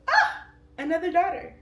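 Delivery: high, loud joyful cry; delighted squeal. Retold or imitated. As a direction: burst with happy surprise. Delight at third daughter.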